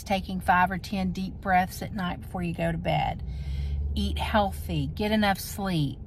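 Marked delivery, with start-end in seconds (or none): none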